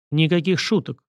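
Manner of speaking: ordinary delivery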